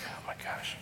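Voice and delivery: barely voiced, whispered disbelief; retold under-the-breath groan of resignation; exasperated whisper. Retold weary reaction to another request.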